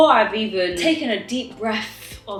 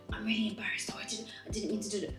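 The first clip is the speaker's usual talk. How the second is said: whispering; 14 dB quieter, much faster than usual. Retold or imitated. Colleague's embarrassed hushed apology.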